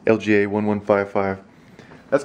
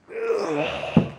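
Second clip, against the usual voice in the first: high mock groan.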